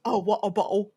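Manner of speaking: Cockney with the t's dropped; Cockney accent. Shown as a demonstration. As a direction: drop the t's, London Cockney. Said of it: "that's Cockney".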